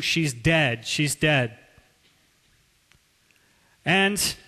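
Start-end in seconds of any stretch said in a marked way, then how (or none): none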